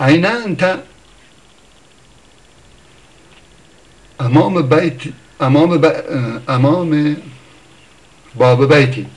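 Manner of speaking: quoted Arabic. Retold or imitated. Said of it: "به قول یارو".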